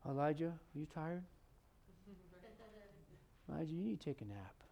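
quiet, tender coaxing; gentle. Retold or imitated. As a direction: gently soothe a worn-out man. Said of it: "He just very gently goes".

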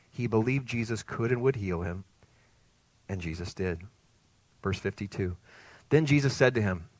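usual speaking voice

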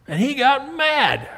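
slow, high and loud on mad; drawn-out indignant emphasis; emphatic. Acted. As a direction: land hard on mad. Preacher stresses Jonah's anger.

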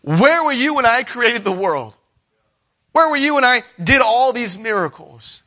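high, loud, rebuking as God; booming voice of God. Acted. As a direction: thunder the questions down at Job.

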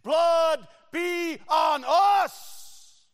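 high, very slow stretched shout; crowd shout. Acted. Crowd shouting back at Pilate, very slow high shout. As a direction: drag it out like a mob taking the blame. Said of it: "And the crowd shouts".

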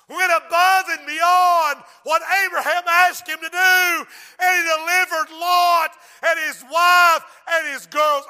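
loud, high, shouted preaching climax; preaching at full force. Acted. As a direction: thunder it out to the whole church.

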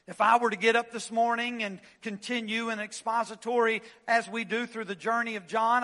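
ordinary talk

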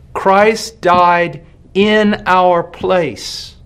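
slow, with "in" weighted in "Christ died in our place"; emphatic, very slow. Shown as a demonstration. Preacher driving the point home: very slow and weighted. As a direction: drag each word out, leaning on "in". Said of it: "This is very important".

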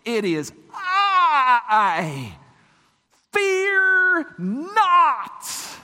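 slow, loud, high proclamation with drawn-out words; mighty, ringing declaration; booming command. Shown as a demonstration. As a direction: thunder it out with full authority. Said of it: "I want to say it more like this".

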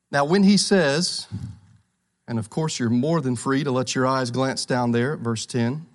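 ordinary talk, nothing marked

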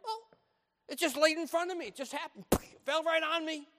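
hammed-up innocent excuse; mock excuse. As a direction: play the innocent sinner.

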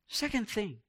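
usual speaking voice

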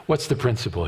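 ordinary talk, nothing marked

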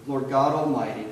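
ordinary delivery

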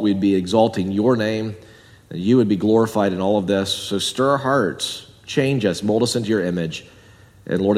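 soft, earnest prayer voice; pastoral prayer. Acted. Pastor prays for his friends in church, hushed and earnest. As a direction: pray quietly over the church.

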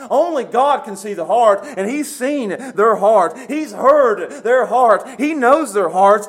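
loud, high preaching that hammers each point; preaching at full force. Acted. Preacher pressing the congregation, full-force preaching. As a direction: preach it hard, pounding the list.

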